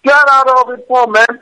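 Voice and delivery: shouting the caller off the line; angry shout. Real, not acted. Man fed up with an abusive caller, yelling angrily.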